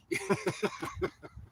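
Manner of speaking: high breathy-voiceless chuckling; amused laugh. Real, not acted. Man amused by his fake apology, laughing. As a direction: crack up at your own mock apology.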